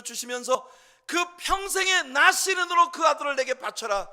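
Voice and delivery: loud, high impassioned preaching; full-force preaching. Acted. Pastor preaching about Hannah's prayer, full force. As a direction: drive the point home to the congregation.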